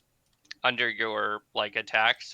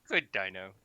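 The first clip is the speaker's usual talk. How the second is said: low, softer pet praise; companion praising pet; 5 semitones lower, 5 dB quieter than usual. Acted. Game master as Huda pats the raptor, low praise. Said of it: "As if to be like".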